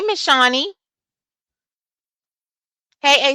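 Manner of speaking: ordinary talk, nothing marked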